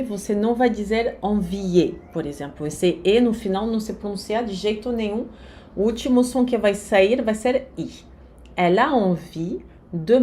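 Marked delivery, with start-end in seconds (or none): none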